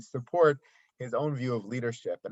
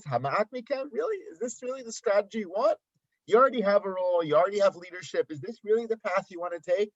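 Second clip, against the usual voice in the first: higher, pleading Moshe; 7 semitones higher than usual. Acted. Moshe asks Korach's followers, higher and pleading. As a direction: Moshe gently asking if this is really the way. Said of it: "Then he says".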